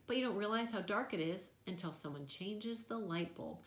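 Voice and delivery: ordinary delivery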